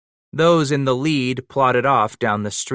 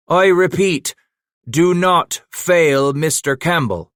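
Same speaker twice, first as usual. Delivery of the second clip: higher, louder and slow, stressing NOT in "do not fail"; loud repeated warning; 8 semitones higher, 5 dB louder than usual. A narrator's reading. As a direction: call it over the crowd, leaning hard on "not". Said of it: "the voice carried over the throng".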